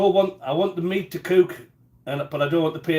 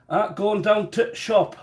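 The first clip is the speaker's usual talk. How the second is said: higher, slow Rotherham dialect; Rotherham accent; 4 semitones higher, slower than usual. Retold or imitated. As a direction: imitate a Rotherham local, drawn out. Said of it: "the way they spoke was".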